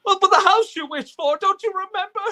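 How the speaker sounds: loud, high, frantic begging; desperate pleading. Acted. Husband begging his wife who forgets him, frantic loud pleading. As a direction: plead with her to remember you.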